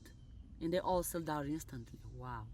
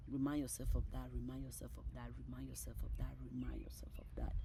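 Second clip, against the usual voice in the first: low murmur fading into whisper; quick, quiet chant dying away; hushed repetition; 5 semitones lower, 8 dB quieter, faster than usual. Real, not acted. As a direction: repeat it like a mantra, softer and softer. Repeated reminder fading away.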